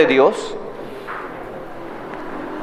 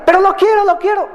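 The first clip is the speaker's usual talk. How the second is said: much higher mock childish whine; whinier child; 12 semitones higher, faster than usual. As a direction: push the begging up a notch, hammed-up. Child begging again, escalated.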